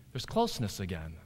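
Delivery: ordinary delivery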